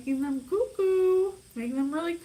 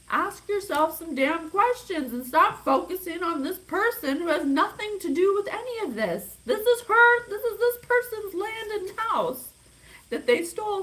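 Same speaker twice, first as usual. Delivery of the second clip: louder and higher, building into an angry outburst; worked-up rant; 7 semitones higher, 5 dB louder than usual. Real, not acted. Psychic scolding the people in her reading, angry loud rant. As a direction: vent real frustration, rising to a near shout. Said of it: "I'm all worked up".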